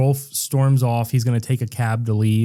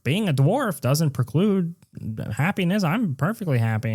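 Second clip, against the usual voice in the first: mock earnest father; 6 semitones higher, 4 dB quieter than usual. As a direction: play the dad gently insisting he's happy. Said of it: "And he's like".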